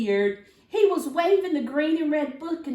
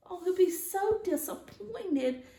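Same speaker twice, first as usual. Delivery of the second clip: sad; 8 semitones higher, 5 dB quieter than usual. Acted. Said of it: "Sophie said".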